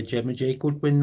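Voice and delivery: ordinary talk, nothing marked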